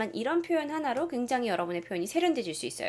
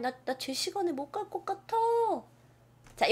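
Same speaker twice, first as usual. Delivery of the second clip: usual speaking voice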